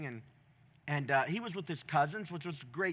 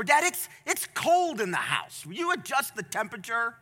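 put-on whining kid; 5 semitones higher, 5 dB louder than usual. Child complains to father about the cold, whining.

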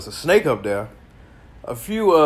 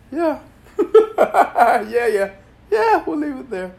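laughing; 13 semitones higher, 5 dB louder than usual. Real, not acted. Laughing off the topic.

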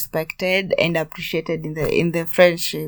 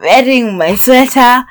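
normal talking voice

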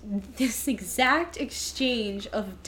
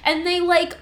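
ordinary talk, nothing marked

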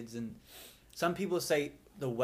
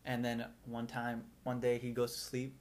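ordinary talk

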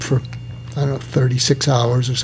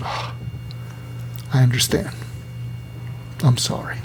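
soft, breathy apology trailing into a whisper; tearful; 8 dB quieter than usual. Retold or imitated. Doctor apologizing to her patient, quietly tearful. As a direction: let the voice fall away, close to tears. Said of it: "I think I got a little tearful".

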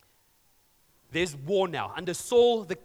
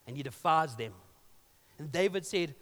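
usual speaking voice

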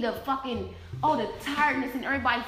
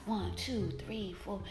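much softer, lower counting; hushed counting; 4 semitones lower, 15 dB quieter, slower than usual. Real, not acted. Counting figures on screen, very soft. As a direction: count under your breath.

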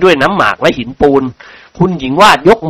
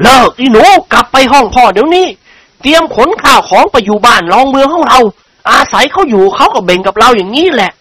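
bellowing; 8 semitones higher than usual. A narrator's reading. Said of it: "ร้องลั่น". (ร้องลั่นสั่งลูกสาว)

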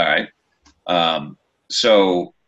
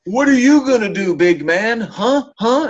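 high, loud taunt; taunting shout; 12 semitones higher, 4 dB louder than usual. Retold or imitated. Heckler taunting the wrestler from ringside, shouting. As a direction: bark it like a fan picking a fight. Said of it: "I start saying something else, going".

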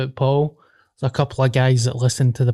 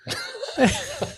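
high laugh; 11 semitones higher, 8 dB quieter than usual. Real, not acted. Laugh after joke.

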